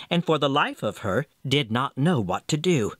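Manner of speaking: ordinary delivery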